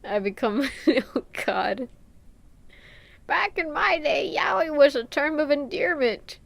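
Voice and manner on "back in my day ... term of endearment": higher put-on old-lady voice, reminiscing; grandma voice. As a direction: lecture the young like a grandma. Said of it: "Grandma Pep".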